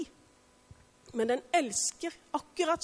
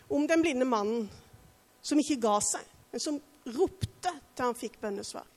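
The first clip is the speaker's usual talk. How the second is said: normal talking voice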